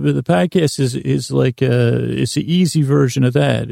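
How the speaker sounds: ordinary talk, nothing marked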